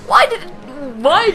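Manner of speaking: sudden high exasperated yelp; exasperated cry. Real, not acted. Gamer exasperated at his own gloom, high cry. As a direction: break the gloom with a squeak. Complains topic turned depressing.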